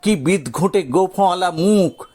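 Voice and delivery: usual speaking voice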